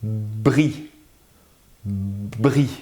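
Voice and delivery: "Bri" with the initial b held before release; emphasised first sound. Shown as a demonstration. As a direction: lean on the b of "Bri", repeated separately. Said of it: "répétez-le plusieurs fois".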